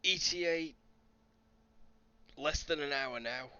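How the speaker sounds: high, loud soldier's warning; urgent calling out. Acted. Soldier warning of a giant monster coming, urgent calling out. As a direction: call it out tensely from a distance. Same soldier calls out the ETA.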